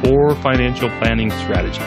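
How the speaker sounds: formal disclaimer read over music; fast legal disclaimer. Acted. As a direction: read the legal fine print evenly.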